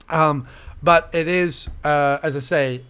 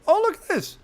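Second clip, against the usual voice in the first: mock surprise; 8 semitones higher than usual.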